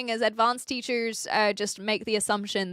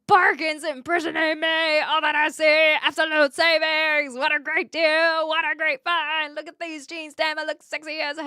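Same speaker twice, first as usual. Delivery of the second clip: growled rock singer voice, higher and louder; 4 semitones higher, 5 dB louder than usual. Retold or imitated. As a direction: belt it like a metal frontman.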